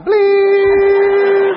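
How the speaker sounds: loud high imitated monitor beep; flatline beep. Husband joking he was about to die, loud flatline beep. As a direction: belt out a heart monitor flatline tone. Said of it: "waiting for the flat line".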